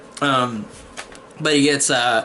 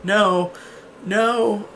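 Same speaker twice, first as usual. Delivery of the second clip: high, mock-tearful wail; half-acted sobbing plea; retold crying; 7 semitones higher than usual. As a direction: imitate a crying girl's drawn-out no, pitched up. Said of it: "And then she just cries".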